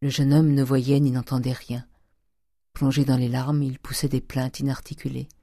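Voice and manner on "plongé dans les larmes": ordinary delivery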